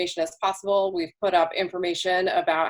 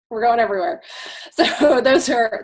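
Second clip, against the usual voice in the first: amused, louder, laughing through the words; 6 semitones higher, 5 dB louder than usual. Real, not acted. Joking about seasick screen.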